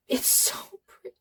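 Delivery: choked up, barely voiced; real tears, whispered; tearful whisper. Real, not acted. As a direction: voice gone to a teary whisper. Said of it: "I am tearing up right now".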